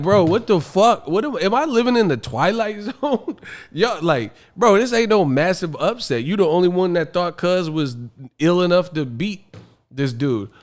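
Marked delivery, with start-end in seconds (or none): none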